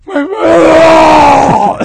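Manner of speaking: high, loud wail for mom; crying "My mom!" at the top of the lungs; crying shout. Retold or imitated. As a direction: sob it out loud like a lost kid. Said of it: "I started crying and crying".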